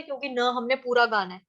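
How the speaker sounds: normal talking voice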